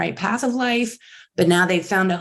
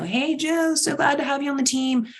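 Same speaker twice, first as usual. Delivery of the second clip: high, bright welcoming greeting; cheery welcome; 7 semitones higher than usual. Retold or imitated. Team welcoming a new hire in a note, high cheery greeting.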